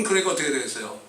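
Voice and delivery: usual speaking voice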